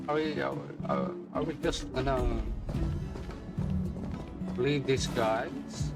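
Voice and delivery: ordinary talk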